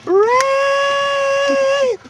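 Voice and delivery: very high, loud, long-held scream; mimicked screech at full volume; imitated screaming. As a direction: stretch one word into a piercing wail. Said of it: "So he stops screaming".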